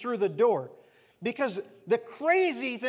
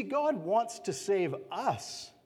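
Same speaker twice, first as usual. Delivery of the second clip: ordinary talk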